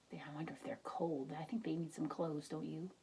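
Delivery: low fast whisper; hushed wondering. Real, not acted. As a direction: confide softly to the listener. Tender aside to children.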